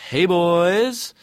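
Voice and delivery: high, loud campy greeting; flirty call.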